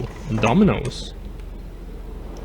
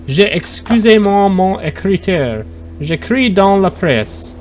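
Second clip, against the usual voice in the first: put-on French accent; 4 semitones higher than usual. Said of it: "You didn't like my French accent?".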